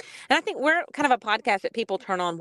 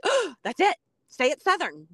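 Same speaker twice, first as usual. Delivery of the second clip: retold lightbulb moment, pitched higher with a gasped "oh"; eureka moment; 8 semitones higher than usual. Podcaster retells the moment they found the name, excited. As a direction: imitate hitting on the perfect idea. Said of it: "And we were like".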